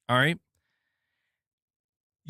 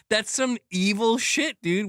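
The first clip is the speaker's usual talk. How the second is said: usual speaking voice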